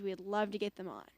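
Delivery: ordinary delivery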